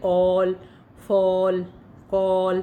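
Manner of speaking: teacher drilling the aw sound; model words. Shown as a demonstration. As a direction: call out ऑल फॉल कॉल for repetition. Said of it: "अब हम पढ़ रहे हैं ओ sound".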